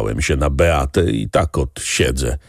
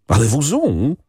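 shrill cry; 7 semitones higher than usual. A narrator's reading. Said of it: "krzyknęła pani Beata piskliwie".

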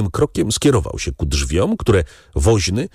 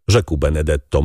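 ordinary delivery

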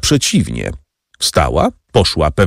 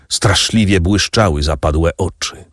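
plain reading voice

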